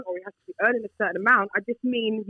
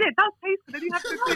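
sudden higher, coy voice; changed tone; 10 semitones higher than usual. Real, not acted. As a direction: slip into a sweeter, higher tone. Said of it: "She changed her tone".

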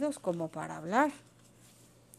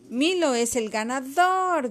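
slow, loud, raised announcement; announcing shout; 5 semitones higher, 5 dB louder, slower than usual. A narrator's reading. Carlos declares Milo the winner, shouting. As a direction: draw out the winner's call. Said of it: "gritó Carlos".

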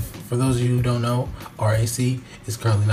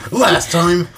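louder, slower "previously on" voice; recap announcer; 5 semitones higher, 3 dB louder, slower than usual. Shown as a demonstration. Host doing a dramatic last-time-on recap, louder and slower. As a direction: narrate the recap like a TV series. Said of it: "I gotta get my voice straight".

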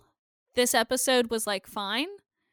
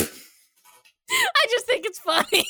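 slow, giggling through the words; laughing; 9 semitones higher, 4 dB louder, much slower than usual. Real, not acted. Host laughs at men getting slapped, giggling. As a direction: laugh it out slowly.

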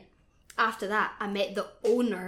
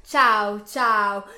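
loud, punched-out retold greeting; mock Italian greeting; 12 dB louder than usual. Retelling greeting the club owner in Italian, mock hearty. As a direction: belt out each word separately, hamming it up.

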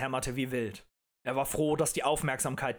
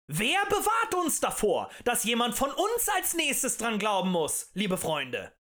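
high rhetorical question easing down at the end; agitator's plea, loud then settling; impassioned appeal; 9 semitones higher, 4 dB louder than usual. A narrator's reading. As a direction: appeal to a crowd's fear.

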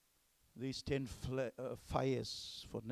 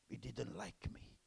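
hushed aside; 3 semitones lower, 10 dB quieter than usual. Real, not acted.

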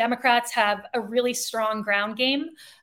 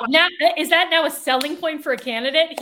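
higher, louder sarcastic disbelief; mock amazement; 5 semitones higher, 6 dB louder than usual. Real, not acted. Co-host mocking a weak selling point, incredulous sarcasm. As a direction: feign being impressed, incredulously. Mocks the co-host's defence.

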